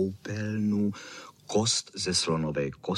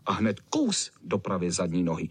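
usual speaking voice

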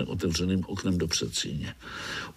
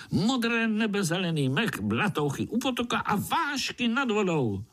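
put-on very high, excited young voice; enthusiastic little spider; 12 semitones higher than usual. A narrator's reading. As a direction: list the wonders in a high-pitched voice. Pája describes the forest. (nadšený vysoký hlásek)

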